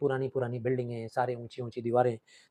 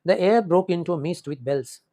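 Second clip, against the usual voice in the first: stretched, very slow, a bit louder; slow poem recitation; 3 semitones higher, 5 dB louder, much slower than usual. Shown as a demonstration. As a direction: read the verse slowly, with weight. Teacher reciting the poem line. (धीमा, ज़ोरदार कविता पाठ)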